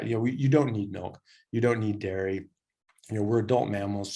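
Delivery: ordinary talk, nothing marked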